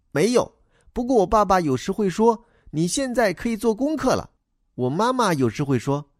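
put-on high, childlike voice for the little boy; young boy replying to the grown-up, high and eager; boy's voice. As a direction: play the earnest schoolboy explaining himself. Boy reports his parents.

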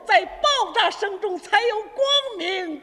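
grandiose, drawn-out poetry recital; inspired poet. Acted. A brother's foot bath sparks a poem, grandiose declaiming. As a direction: declaim each phrase like a fevered poet. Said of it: "激发了我的灵感".

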